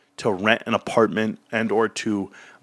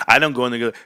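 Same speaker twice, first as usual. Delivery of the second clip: ordinary delivery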